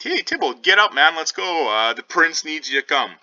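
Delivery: high, loud call to a friend lying down. Acted. The watch calls to Tybalt lying there, high loud call. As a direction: shout over to him to get up and go.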